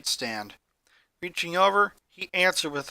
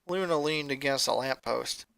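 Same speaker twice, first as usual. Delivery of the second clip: usual speaking voice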